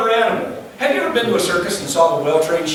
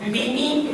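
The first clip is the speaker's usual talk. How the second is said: mock tiny horn beep, pitched up and soft; weak car horn; 4 semitones higher, 6 dB quieter than usual. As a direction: squeak like a puny horn. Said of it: "But the horn, really?".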